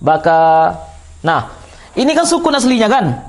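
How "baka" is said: "Baka" with the final "a" drawn out two counts; one-alif long vowel. Shown as a demonstration. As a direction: hold the end of "Baka" long. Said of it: "Dua harokat itu satu alif". ("Baka" dengan "a" akhir dipanjangkan satu alif)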